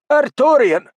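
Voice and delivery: high, loud call of the name. Acted. Don calling Artorian over, high and loud. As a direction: call him over sharply.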